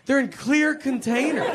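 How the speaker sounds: mock manager high. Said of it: "And the guy's like".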